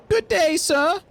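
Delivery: very high little newsboy voice; paper boy. Acted. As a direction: greet like an eager paper boy. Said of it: "Talk to the paper boy".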